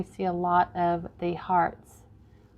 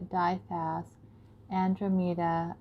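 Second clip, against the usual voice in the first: ordinary talk, nothing marked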